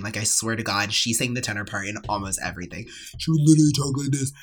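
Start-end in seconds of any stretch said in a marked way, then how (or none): 3.2-4.3 mock bass-voiced girl; Zoe. Singer mimicking classmate who sang tenor, mock bass. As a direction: put on an exaggerated bass talking voice. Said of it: "had the voice of a, of a bass".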